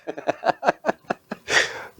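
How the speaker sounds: high, airy self-mocking laugh. Real, not acted. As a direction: laugh at your own foolishness.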